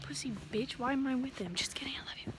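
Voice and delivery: whispering. Pretend. Teasing boyfriend in aside.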